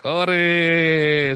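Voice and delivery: loud, drawn-out hooray; playful cheer. Pretend. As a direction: cheer like an applause sound effect. (sorakan hore panjang pura-pura)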